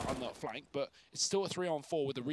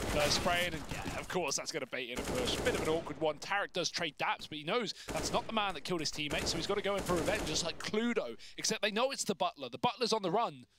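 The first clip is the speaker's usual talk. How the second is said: louder, rising live commentary; excited play-by-play; 4 semitones higher, 4 dB louder than usual. Acted. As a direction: build the hype on the fight.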